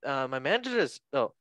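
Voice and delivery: badly faked Scouse with questioning swoops; Jamie Carragher. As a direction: attempt Scouse, lilting up like a question. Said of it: "sound like a question as a scout".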